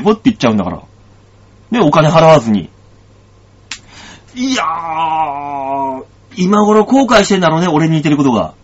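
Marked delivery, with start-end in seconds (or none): 4.4-6.0 long sigh. Real, not acted. Drawn out sigh.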